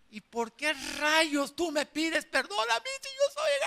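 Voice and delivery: a husband yelling at his wife in disbelief, high; high-pitched shouted retort; mock shout.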